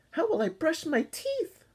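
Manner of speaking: high whiny moan.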